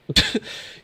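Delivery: breathy teary laugh after crying; tearful laugh. Real, not acted. Streamer laughing through tears after reading speech.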